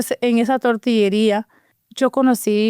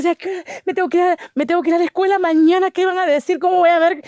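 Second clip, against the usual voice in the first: nervous excitement; 7 semitones higher than usual. Retold or imitated.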